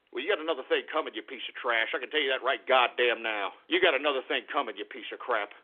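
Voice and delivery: low menace. Real, not acted. Threat after the shouting.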